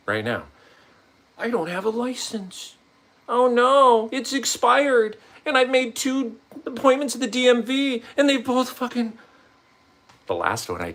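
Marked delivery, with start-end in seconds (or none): 1.4-9.2 mock panicked self.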